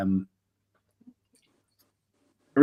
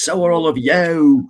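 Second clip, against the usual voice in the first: loud, raised mock rant; Travis ranting; 7 semitones higher, 9 dB louder than usual. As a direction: imitate an over-the-top angry outburst, louder and higher. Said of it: "where he's ranting at the".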